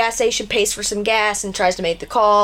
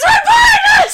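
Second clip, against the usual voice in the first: high laughing shout of panic; mock panic; 7 dB louder than usual. Storyteller acting the boys' terror, high laughing shout. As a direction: shriek it, half laughing.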